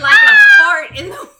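laughing shout. Real, not acted. Cohost joking the wine goes poof, laughing shout. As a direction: blurt it out cracking up.

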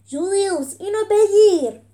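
loud, high urgent call; shouted throw-and-catch cue. A narrator's reading. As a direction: yell across the arena. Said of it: "صدایی بلند شد".

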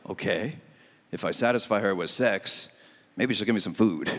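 low, deadpan inner voice, reversed deal; married guy's thinking. Retold or imitated. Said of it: "Then it's like".

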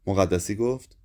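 ordinary delivery

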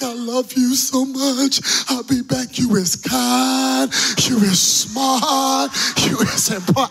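high, loud, slow sobbing voice; hammed-up crying farewell; weepy blubbering. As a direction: wail it like a heartbroken dad. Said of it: "I was a mess".

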